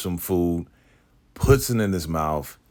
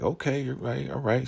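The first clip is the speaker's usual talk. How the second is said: agreeable woman; 9 semitones higher, 3 dB quieter than usual. Retold or imitated. Voicing woman agreeing to take Zane.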